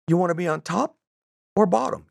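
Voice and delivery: high, soft mock Southern lilt; put-on Southern accent, lifted pitch. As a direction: ask softly as a high-voiced Southern gent. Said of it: "I don't have a very good Southern accent".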